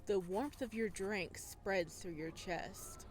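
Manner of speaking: calm, measured storytelling voice; story narration. A narrator's reading. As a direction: read it like an audiobook narrator.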